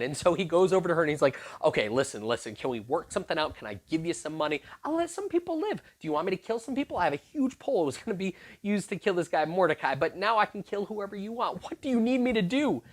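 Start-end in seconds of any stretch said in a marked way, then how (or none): 1.6-12.8 frantic bargaining. Retold or imitated. Haman bargaining for his life.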